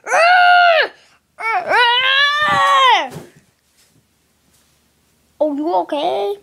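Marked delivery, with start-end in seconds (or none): 0.1-3.1 long, loud, high play scream; angry bird scream. Pretend. Playing the angry bird, long high furious screams. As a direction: scream out in cartoon rage.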